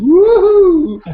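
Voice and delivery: loud, high woohoo; excited cheer. Real, not acted. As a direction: cheer like you just won. Cheers at waking on time.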